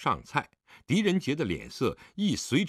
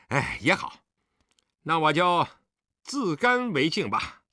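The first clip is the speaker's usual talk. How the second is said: forced nonchalance, pitched up; 干笑道; 5 semitones higher than usual. A narrator's reading. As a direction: cover the snub with a dry, raised voice. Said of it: "脸色略变阴沉， 干笑道". (强作无事地干笑着说)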